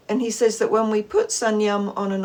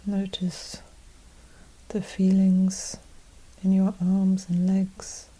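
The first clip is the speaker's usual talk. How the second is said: guided meditation; 2 semitones lower, 4 dB quieter than usual. Acted. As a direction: gently guide them inward, unhurried and even, leaving space after each phrase.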